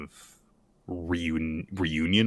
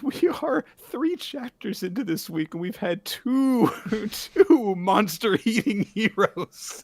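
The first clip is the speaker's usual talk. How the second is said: laughing; 13 semitones higher than usual. Real, not acted. Still laughing at the chapter.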